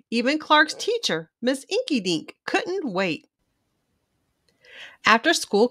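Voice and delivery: lively storybook read-aloud; reading to a child. A narrator's reading. Children's book narrator reading aloud to young viewers. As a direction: read it bright and animated for little ones.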